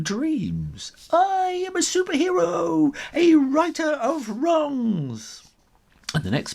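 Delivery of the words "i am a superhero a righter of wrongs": much higher and louder, grand; heroic declaration. Acted. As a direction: proclaim it like a caped hero. Ginger dreams big.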